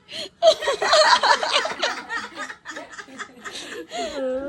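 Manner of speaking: long, loud, very high cackle; helpless laughing fit. Real, not acted. Emotional outburst over surprise gift. (mahaba at matinis na halakhak)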